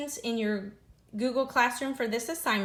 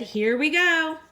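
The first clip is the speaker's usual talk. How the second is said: louder, higher, bright push; cheerful resolve; 6 semitones higher, 6 dB louder, slower than usual. Acted. Lily carrying Ginger down the aisle, cheerful and loud. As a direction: lift her and go.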